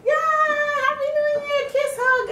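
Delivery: loud, very high mock celebration; imitated cheering. Family bursting out at New Year, imitated high cheer. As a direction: whoop it high like an excited family.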